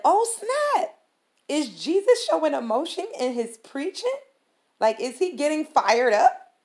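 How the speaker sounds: high, loud burst of amazed discovery; re-enacted excitement, pitched well up; excited realization. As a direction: blurt it out, thrilled by the discovery. Retells her own excited realization.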